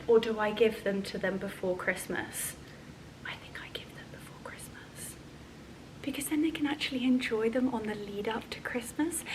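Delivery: hushed whisper, like a secret plan. Real, not acted. Vlogger whispering plans for the girls' mugs.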